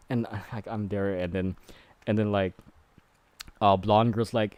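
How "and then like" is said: ordinary delivery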